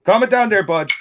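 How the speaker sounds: high, loud, hammed-up Canadian "bud"; mock Canadian accent. Teasing the Canadian friend on stream, mock Canadian accent.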